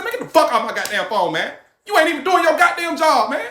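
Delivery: angry. Retold or imitated. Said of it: "I said".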